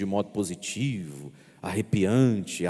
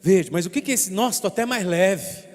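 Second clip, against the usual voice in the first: imitating a penitent; 10 semitones higher, 5 dB louder than usual. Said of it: "penitent quote".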